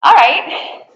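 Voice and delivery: high, loud cheer; excited. Real, not acted. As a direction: cheer the class on. Loud cheer after the push-ups.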